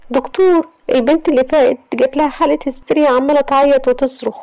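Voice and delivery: high, fast, urgent woman's voice; alarmed nurse. A narrator's reading. The nurse runs out to tell the doctor, urgent and high. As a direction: rush the news out in a panic.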